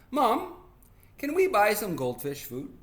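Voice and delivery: put-on high, eager kid voice; child asking mom. Boy asking mother for goldfish food, high childlike request. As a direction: pipe up high, calling for Mom.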